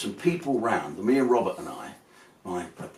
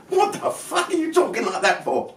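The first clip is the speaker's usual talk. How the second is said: retold incredulity; 12 semitones higher than usual. Challenges friend's put-on accent.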